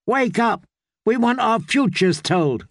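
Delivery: put-on high, bright girl's demand; a spoiled little girl barking orders; lightly voiced girl demanding her fortune; bossy young girl. Bold girl waking the witch.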